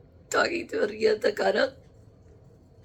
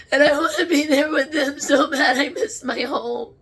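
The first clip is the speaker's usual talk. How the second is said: louder, tearful longing; crying; 5 semitones higher, 6 dB louder than usual. Real, not acted. Medium longing for heaven, crying. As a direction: yearn through tears. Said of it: "I'm sorry to cry".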